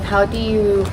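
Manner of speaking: normal talking voice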